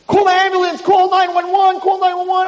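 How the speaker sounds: high, urgent cry for an ambulance; panicked call for help. Acted. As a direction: sound the alarm in a panic.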